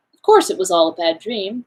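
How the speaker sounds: ordinary delivery, nothing marked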